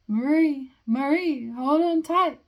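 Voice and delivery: high, loud sing-out with swooping pitch; calling out. Acted. Cousin calling to Marie on a sled, loud high call. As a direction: cry it out excitedly over distance.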